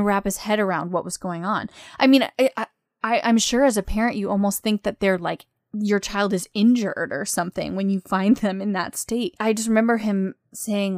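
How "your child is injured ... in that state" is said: usual speaking voice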